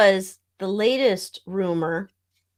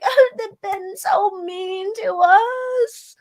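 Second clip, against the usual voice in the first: high, slow whiny complaint; whiny mock voice; 11 semitones higher, 3 dB louder, much slower than usual. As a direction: whine it like a spoiled child. Mocking the couple's complaints.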